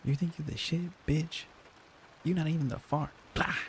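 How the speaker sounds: high-pitched, badly faked cartoon voice; high, goofy mock cartoon taunt; Toad. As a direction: squeak out a silly character impression with noises. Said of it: "I can't do the Toad voice".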